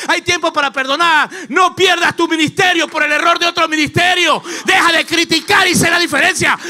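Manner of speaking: high, loud revival preaching at full force; impassioned sermon cry, driving and relentless; fiery preaching. Real, not acted. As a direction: shout it out urgently to the whole congregation.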